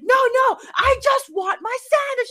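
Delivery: retelling an angry customer yelling at the cashier; imitated yelling. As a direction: bellow it like the impatient customer. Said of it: "He was, like, yelling at me".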